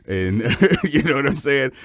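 breaks into a high, loud laugh; real laugh, voice up high. Real, not acted. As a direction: laugh through the question. Laughs mid-line.

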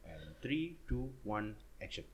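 soft, low director's countdown; hushed count-in. Pretend. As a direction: quietly count the presenter into a take.

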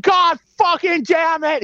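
high, slow hammed-up shout; mock furious shout. Husband soaked with water, shouting way up high and slow. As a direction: overact the curse like DiCaprio. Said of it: "He's like".

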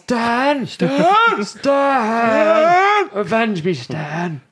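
hammy dying cry, high and loud; overacted death scene. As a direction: wail his name like a dying man. [name] [name] skit, dramatic cry.

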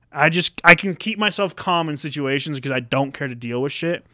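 ordinary talk

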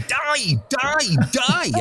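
high chanted "Die, die, die" like fans on a climb; high crowd chant; imitating a roadside crowd. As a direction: mimic the crowd yelling at riders. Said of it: "five thousand people going".

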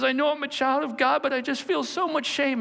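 lightly voiced high ashamed woman; ashamed girl. Ashamed daughter of God confessing in court, mildly high. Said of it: "she goes".

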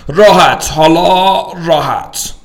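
high, slow coaxing "راحت"; fingerprinting cop. Acted. Cop tells him to relax his thumb, high and slow. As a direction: draw out the word slowly.

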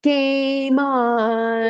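drawn-out, sing-out "game on"; imitated catchphrase. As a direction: stretch it into a high, held call. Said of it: "what does he say".

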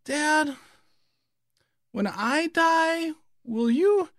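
put-on a little boy asking earnestly, high and slow; small child's voice. Son at bedtime asks dad about dying, high, slow child voice.

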